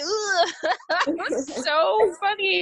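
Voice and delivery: said through laughter, high and slow; can't stop laughing telling it; real laughter. Real, not acted. Laughing after retelling.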